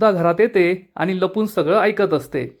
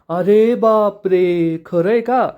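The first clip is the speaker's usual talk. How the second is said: long drawn-out mock exclamation, rising at the question; mock astonished yell; 3 semitones higher, much slower than usual. A narrator's reading. Dhanya reacts to news of Panya's love, mock yelling. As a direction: draw it out like a storyteller's mild shout. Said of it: "धन्या जोरात ओरडतो".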